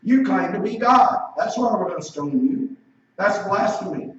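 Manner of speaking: emphatic accusing preacher voice; forceful accusation. Acted. Acts out the accusers' charge.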